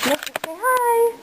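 sing-song high greeting for the dog; pet voice. Real, not acted. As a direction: coo it to the pet. Turning to greet her pet.

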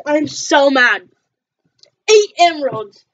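ordinary talk, nothing marked

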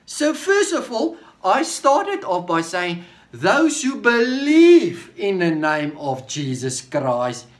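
high, loud, impassioned preaching; preaching at full force. Acted. Preacher exhorting the congregation at full force. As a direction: lift the voice and drive each phrase home.

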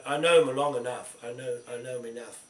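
usual speaking voice

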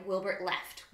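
plain reading voice

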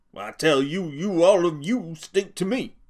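angry outburst. A narrator's reading. Crooks lashing out at the bunkhouse men, angry. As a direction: throw the insult back at them all.

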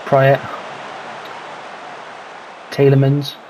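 normal talking voice

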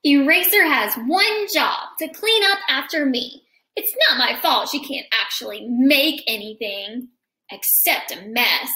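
lively picture-book read-aloud; reading to children. A narrator's reading. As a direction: read it to a class of kids.

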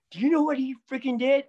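high, loud indignant complaint; a hammed-up angry stylist; mock outraged guy. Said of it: "with the most serious face, he goes".

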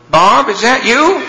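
high, slow, timid voice on the speaker; man on the bridge phone, a lightly voiced plea; voice over the speakerphone. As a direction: ask it small and far off, hoping. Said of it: "you can hear [name]'s voiceover go".

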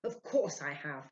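a touch of irritation, slowly; mildly snappish spirit; irritated. A narrator's reading. Spirit answering Ludwig, mildly irritated. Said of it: "swift and irritated manner".

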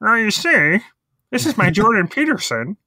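a hint of a Kermit-like voice. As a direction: lightly mimic a funny nasal puppet voice. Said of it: "that funny Kermit voice".

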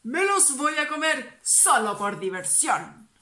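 loud monster roar. Acted. Monster greeting the toads with a loud roar. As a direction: greet them like a hungry beast.

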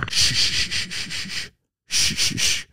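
soft hushes with a low "good horse"; soothing horse-hushing. Shown as a demonstration. Host shows officer how to calm a horse, soothing. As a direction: hush it gently, praise it low. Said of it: "You just go".

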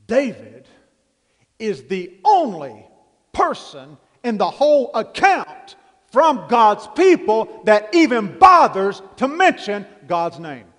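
slow, weighty emphasis on only; emphatic slow point. Real, not acted. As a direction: lean on each word to make them notice. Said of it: "Did you catch that?".

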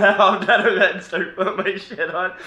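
words pushed out through laughter; can't get through it without cracking up; laughing while telling. Retold or imitated. Telling Gary how he bolted, laughing throughout. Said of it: "she cracked up fucking laughing".

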